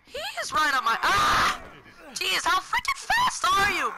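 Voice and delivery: high, panicked shout breaking into a scream; shrieking alarm at being chased. Real, not acted. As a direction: cry out as the pursuer closes in. Chased closely in game.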